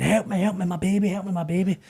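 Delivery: mock desperate scream. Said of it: "the woman's screaming".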